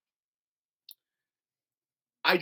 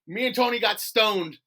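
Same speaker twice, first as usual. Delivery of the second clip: ordinary delivery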